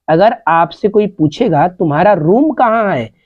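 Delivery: ordinary talk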